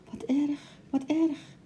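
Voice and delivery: high, hushed dismay; startled little cry of concern; shocked. A narrator's reading. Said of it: "zei ze geschrokken".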